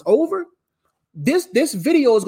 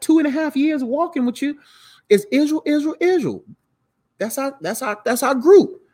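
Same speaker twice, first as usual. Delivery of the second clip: higher, doubting disciples pushing back on Jesus; incredulous disciples; 5 semitones higher than usual. Retold or imitated. Disciples challenging Jesus about Israel, higher and incredulous. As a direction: play the confused disciples, voice pitched up.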